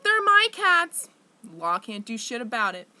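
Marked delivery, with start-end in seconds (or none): 0.0-1.1 much higher, louder, slow mock whine; mocking ex-boyfriend. Mocking the ex who took the cats, shrill mock whine. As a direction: mimic him snidely. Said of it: "he can just be like".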